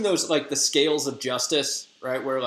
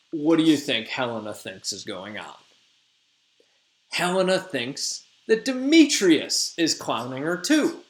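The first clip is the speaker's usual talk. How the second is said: plain reading voice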